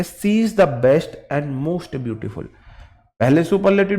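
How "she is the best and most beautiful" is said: slow model answer. Shown as a demonstration. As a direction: read slowly, word by word. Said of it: "अब answer मेरा ठीक है". (सही उत्तर धीरे-धीरे बोलकर लिखवाना)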